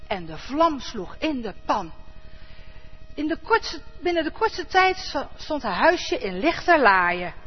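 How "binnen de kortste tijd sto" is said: ordinary delivery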